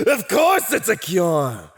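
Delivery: high, loud, straight out of a cackle; gleeful exclamation. Acted. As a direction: cry it out with villainous glee. Villain exclaims after cackle.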